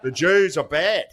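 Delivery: high, drawn-out mock Australian accent; slow, high-pitched Australian impression. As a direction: imitate a kidding celebrity in exaggerated Australian. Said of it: "He's doing the Australian accent".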